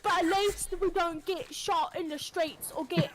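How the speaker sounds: mock British retort; British accent. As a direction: play a defensive Brit. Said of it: "British people be like".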